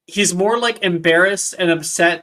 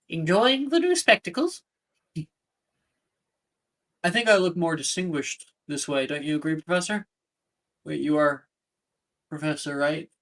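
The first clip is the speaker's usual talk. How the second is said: Professor Flitwick voice. Acted. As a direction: play the tiny fussy professor. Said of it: "Professor Flitwick goes by".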